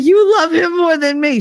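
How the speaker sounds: very high, hammed-up wail; melodramatic accusation. Brother jealous of the new baby, mock melodramatic. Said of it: "he was so melodramatic about it".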